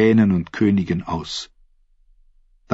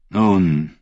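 ordinary delivery, nothing marked

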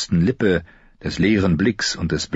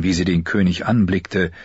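ordinary delivery

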